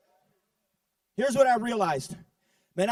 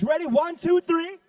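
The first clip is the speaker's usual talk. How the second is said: loud, high crowd-pumping count; hype countdown; 6 semitones higher, 4 dB louder than usual. Real, not acted. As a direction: fire up the crowd. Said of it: "put a hand up like this and say".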